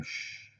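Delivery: a sharp hushing hiss; shushing. Pretend. Hushing his chat before a sneaky name. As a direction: shush yourself as if someone might hear.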